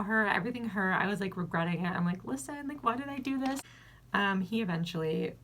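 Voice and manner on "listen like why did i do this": high despairing self-question. Retold or imitated. Regretting it mid-act.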